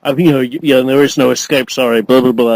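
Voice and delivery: a hint of a lazy movie villain; bored bad guy. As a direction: toss off the threat like a bored henchman. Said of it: "the usual bad guy shit".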